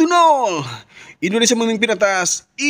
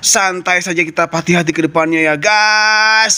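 reassuring; 8 semitones lower than usual. Acted. Consoling the goalkeeper.

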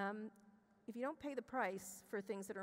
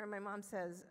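normal talking voice

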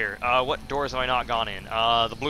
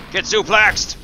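loud, higher wrestling call; triumphant battle cry; 7 semitones higher, 6 dB louder, much slower than usual. Real, not acted. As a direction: yell it as the move lands. Calling out a wrestling move.

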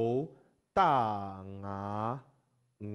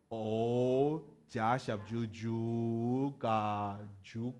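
very slow, syllable by syllable; slow spelling out; much slower than usual. Shown as a demonstration. Teacher showing how to spell it, slow. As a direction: draw out each syllable. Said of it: "我们会怎么拼".

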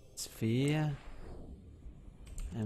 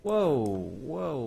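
startled; 4 semitones higher, 5 dB louder than usual. Real, not acted. Startled by something in the base.

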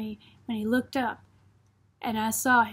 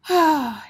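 audible, falling sigh; sighed out in resignation; 3 semitones higher, 5 dB louder than usual. A narrator's reading. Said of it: "He sighed".

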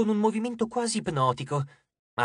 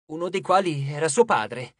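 plain reading voice

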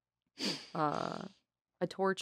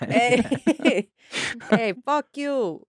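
loud tough New York guy; New York accent; 7 semitones higher, 6 dB louder, much slower than usual. Shown as a demonstration. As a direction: bark it like a Brooklyn tough. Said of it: "Something like that".

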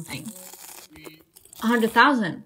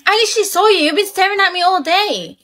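high accusing; 5 semitones higher than usual. Acted. Confronts Jake for staring.

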